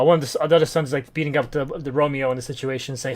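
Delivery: usual speaking voice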